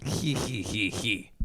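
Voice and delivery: quiet hee-hee giggle; soft breathy giggles. Real, not acted. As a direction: let out a little giggle. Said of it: "I got the giggles".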